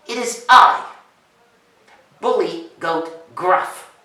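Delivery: loud, grand announcement; slow down and proclaim the name; self-important goat. Acted. Goat announcing himself to little ogre, loud and grand.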